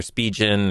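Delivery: Spigen said as SPEE-jen; pronunciation variant. Shown as a demonstration. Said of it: "I don't know if it's called".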